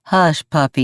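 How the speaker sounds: talking through a mouthful; mouth full. A narrator's reading. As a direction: say it around a bite of food. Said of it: "he told Sal through a full mouth".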